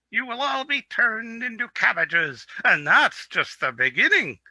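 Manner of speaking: high, strange doom-crying goat; prophetic goat. Acted. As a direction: warn of cabbage doom like a mad goat.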